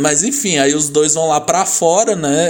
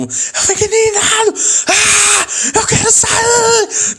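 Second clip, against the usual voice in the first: much higher, hammed-up outraged cry, slow at the end; exaggerated indignant wail, pitched way up; mock furious Gil; slower than usual. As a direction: shriek it like a man who wants out. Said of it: "o Gil fica puto".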